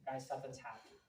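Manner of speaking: showing how serious news is told; serious hush. Shown as a demonstration. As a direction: drop it to a solemn hush. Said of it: "the way that they say things".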